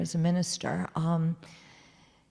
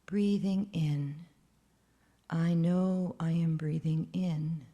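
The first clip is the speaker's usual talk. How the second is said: guided meditation. Acted. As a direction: guide breathing calmly and gently.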